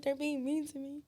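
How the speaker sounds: a lightly hammed-up whine; mock whiny complaint. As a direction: lightly overplay the victim.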